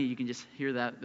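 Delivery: ordinary talk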